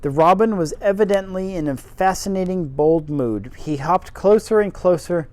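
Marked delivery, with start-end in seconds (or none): none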